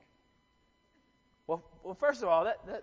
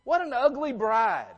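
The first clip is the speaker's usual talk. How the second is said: mock outrage; 7 semitones higher, 6 dB louder than usual. Mocking people who insult bride.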